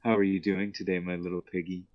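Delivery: usual speaking voice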